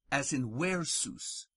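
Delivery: ordinary talk, nothing marked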